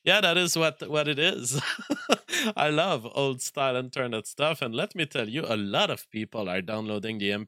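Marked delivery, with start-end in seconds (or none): 1.7-2.5 high burst of real laughter; amused chuckle; laughing. Real, not acted. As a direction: break into a laugh. Laughing at own old-style internet remark.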